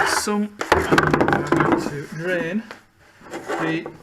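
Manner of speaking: talking while lying under a car. Real, not acted. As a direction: speak on your back under a car, slowed down. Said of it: "I'm under the car right now".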